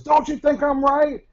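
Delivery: high, loud, slow demanding shriek; enraged customer. Retold or imitated. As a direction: hammer the question at the scared stock boy. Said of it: "she was in a rage".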